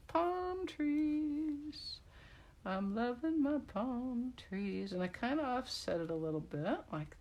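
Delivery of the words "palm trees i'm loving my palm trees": much higher, slow cooing; cooing delight. Real, not acted. Crafter delights in her palm tree stamps, in a high slow coo. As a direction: croon over the palm trees like a treasure.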